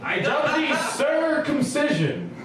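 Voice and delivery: high, slow, shouted royal decree; grand royal proclamation. Pretend. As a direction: proclaim it like a pompous king. Mock knighting ceremony climax.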